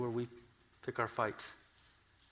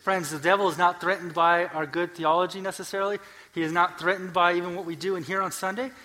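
loud, raised, emphatic sermon delivery; forceful preaching; 6 semitones higher, 8 dB louder than usual. Real, not acted. Preacher telling friends what does not threaten the devil, forceful preaching. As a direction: press each point on the congregation.

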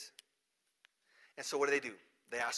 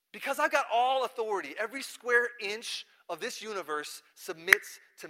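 loud, higher, emphatic declaration; forceful proclamation; 6 semitones higher, 7 dB louder than usual. Retold or imitated. Jesus sends disciples to every nation, forcefully proclaiming. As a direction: proclaim total rule with full force.

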